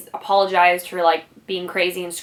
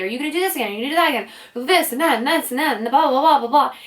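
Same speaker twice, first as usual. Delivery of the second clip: higher-pitched imitation of a nagging tirade; mock raised voice; 6 semitones higher, much faster than usual. Boyfriend dredging up her every mistake, mock raised voice. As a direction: rattle it off higher, mimicking his rant into blahs. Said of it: "raising his voice about talking".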